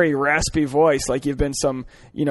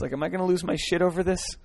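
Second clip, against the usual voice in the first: normal talking voice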